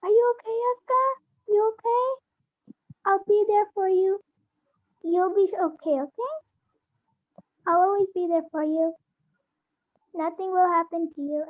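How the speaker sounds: caring little brother. Acted. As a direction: play the kind brother soothing her. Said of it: "And then this is my brother".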